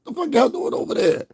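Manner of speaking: squeaky high demand of what they're doing; high incredulous mock voice; high mock question. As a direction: pitch it way up in disbelief. Mock asks people over there.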